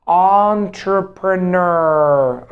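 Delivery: Entrepreneur drawn out slowly; slow model word. Shown as a demonstration. Teacher drilling the word for learners, slow. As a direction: stretch Entrepreneur syllable by syllable. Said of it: "teacher drills the word".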